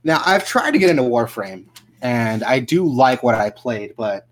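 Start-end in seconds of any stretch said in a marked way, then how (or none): 3.9-4.3 hiccuping mid-sentence; hiccups. Real, not acted. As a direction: catch a hiccup on the word. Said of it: "excuse me, the hiccups".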